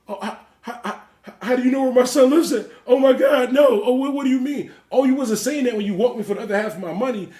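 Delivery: high, loud, hammed-up panicked debtor with a laugh; over-the-top frightened pleading; mock panic. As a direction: squeal it like a terrified man. Mimicking the scared debtor's panic.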